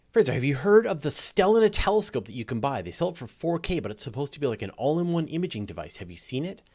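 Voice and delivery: ordinary talk, nothing marked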